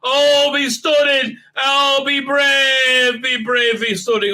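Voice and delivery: high, loud, drawn-out cheer; hammed-up heroic chant with long stretched vowels; mock rallying cry. The crew being stunning and brave, in an exaggerated mock cry. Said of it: "they're being stunning and brave".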